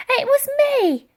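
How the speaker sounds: put-on an old lady quickly taking the blame; high bright chime-in; chiming in. A narrator's reading. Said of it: "chimed in Dotty".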